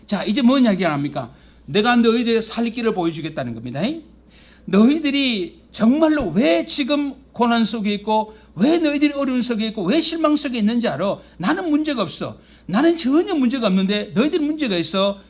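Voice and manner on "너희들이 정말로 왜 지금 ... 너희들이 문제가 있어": loud, rising rebuke from God. Acted. God rebukes his people, loud and high. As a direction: thunder it down as God.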